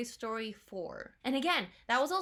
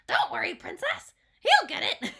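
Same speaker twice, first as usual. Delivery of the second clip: much higher and louder chirpy voice; squeaky toad voice; 9 dB louder than usual. Pretend. Fan voices Toad consoling the princess, squeaky and high. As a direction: chirp it like an eager little helper. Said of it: "I'm sorry, I keep doing that".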